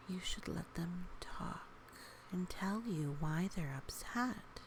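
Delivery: plain reading voice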